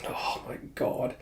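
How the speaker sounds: breathless whisper. Real, not acted. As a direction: whisper it in disbelief. Reacting to the scene.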